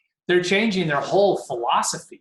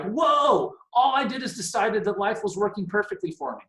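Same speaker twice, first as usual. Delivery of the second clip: amazed realization; 5 semitones higher than usual. Acted. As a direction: play a sudden happy revelation. Acts out a sudden happy realization.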